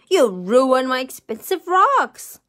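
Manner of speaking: loud, indignant bellowing. A narrator's reading. Rich man scolding the wet princess, bellowing. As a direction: bellow the accusation. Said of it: "he bellowed".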